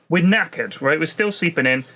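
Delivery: normal talking voice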